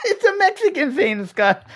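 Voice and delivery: high-pitched laughter through the words; laughing. Real, not acted.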